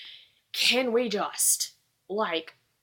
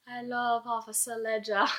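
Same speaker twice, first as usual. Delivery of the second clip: put-on light, softer British accent for Anne's line; 6 dB quieter than usual. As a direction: say it in a dreamy, playful British accent. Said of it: "Why did I say that with a British accent?".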